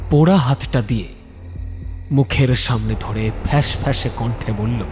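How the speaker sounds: ordinary delivery, nothing marked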